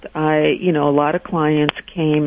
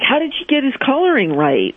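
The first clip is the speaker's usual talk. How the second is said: higher, astonished question; stunned she got it right; amazed; 9 semitones higher, faster than usual. Retold or imitated. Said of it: "I'm like".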